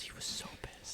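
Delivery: whispered aside. Real, not acted. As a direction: drop to a whisper for emphasis. Repeats the line as an aside.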